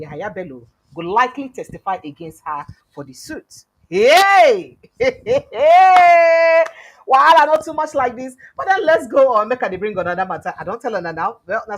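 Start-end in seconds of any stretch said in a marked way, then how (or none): 5.0-6.8 big mocking burst of laughter; loud laugh. Real, not acted. Mocking laugh at political news.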